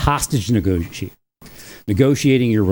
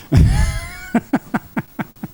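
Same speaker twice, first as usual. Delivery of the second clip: very high burst of laughter; squealing start tumbling into quick chuckles; delighted laugh. Real, not acted. Joking about his middle name, high delighted laugh.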